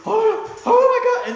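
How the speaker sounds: high, loud, slow hammed-up terror; mock panic. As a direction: squeal like a sailor scared silly. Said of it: "these people to be".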